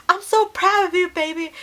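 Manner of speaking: high, doting proud-dad voice. Retold or imitated. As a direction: croon it like a beaming country dad. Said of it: "be like".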